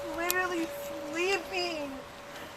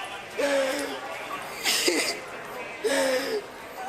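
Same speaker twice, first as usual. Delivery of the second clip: sob; 3 semitones lower than usual. Real, not acted.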